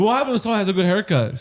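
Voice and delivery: normal talking voice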